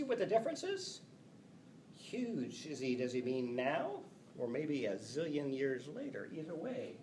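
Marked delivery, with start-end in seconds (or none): none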